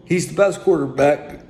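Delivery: mock over-the-top praise. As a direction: gush about him like a superfan. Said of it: "oh, excuse me".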